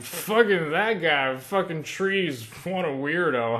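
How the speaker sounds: hammed-up scornful gossip; gossiping mockery. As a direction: trash a patient to colleagues at the water cooler. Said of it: "I'd just be talking with them like".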